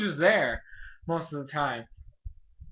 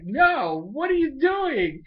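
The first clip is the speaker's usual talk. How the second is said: high, loud, stretched-out protest; mock exasperation; 11 semitones higher, 7 dB louder, slower than usual. Brother reacting to his sister's matchmaking, mock exasperation. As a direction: object loudly in hammed-up dismay.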